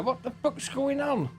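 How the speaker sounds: real disbelief, pitch jumps way up; genuinely can't believe the game; baffled frustration. Real, not acted. Gamer finding progress stolen again, real baffled outburst.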